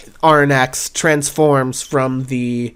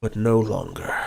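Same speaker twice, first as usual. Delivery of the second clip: dragon's resolve; 4 semitones lower, 5 dB quieter than usual. Acted. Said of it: "as he says "no longer".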